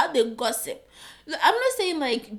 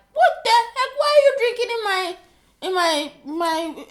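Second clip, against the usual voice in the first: high, loud indignant what the heck; outraged surprise; 11 semitones higher, 11 dB louder than usual. Real, not acted. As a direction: catch him drinking mid-lesson, loud and high. Partner drinking during the video.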